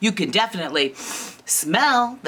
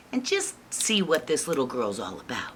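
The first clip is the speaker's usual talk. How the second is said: plain reading voice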